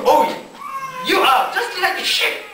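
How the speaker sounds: high, shouted jeer; mock insult. A Middle Easterner insulting someone, shouted mock insult. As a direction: fling it at someone as a taunt. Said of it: "if somebody say to you".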